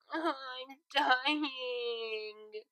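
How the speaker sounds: high, whiny sick voice; melodramatic whine. A narrator's reading. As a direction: ham up the suffering.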